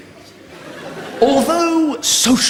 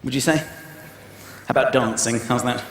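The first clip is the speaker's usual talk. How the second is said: much lower, soft blokey growl; lower, quieter mock tough guy; macho voice; 10 semitones lower, 4 dB quieter than usual. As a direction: tease him in a deep manly voice.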